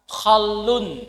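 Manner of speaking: Khalun with a rasping kh and a doubled l: khal-lun; careful pronunciation. Shown as a demonstration. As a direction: stress the long l in Khalun. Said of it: "nggak boleh bacanya salah".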